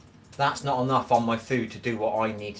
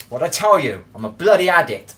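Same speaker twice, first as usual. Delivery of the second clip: loud, raised-pitch outburst; emphatic retort; 6 semitones higher, 10 dB louder than usual. Real, not acted. Streamer answering a troll in chat, loud emphatic retort. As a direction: throw it back louder and higher.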